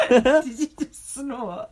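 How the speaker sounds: slow question squeezed out through laughter; real laughter breaking up the words; laughing hard. Real, not acted. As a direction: ask it while cracking up. Laughing at other's snort.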